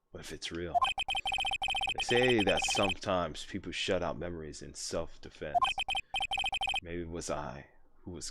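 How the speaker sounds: soft, hushed dialogue read; quiet story narration. Acted. Reads game dialogue softly.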